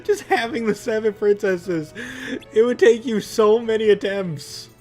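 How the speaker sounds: usual speaking voice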